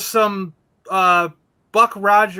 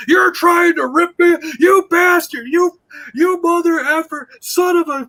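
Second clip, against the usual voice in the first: raised, high-pitched angry rant; going nuts; 9 semitones higher, 3 dB louder than usual. Retold or imitated. Toy dealer accusing a haggling customer, going nuts. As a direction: imitate a seller blowing up, pitch way up. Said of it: "went nuts".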